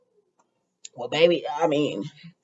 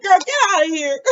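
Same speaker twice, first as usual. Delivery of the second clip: laughing dismissal; 8 semitones higher, 4 dB louder than usual. Real, not acted. Laughing at crying Sheila.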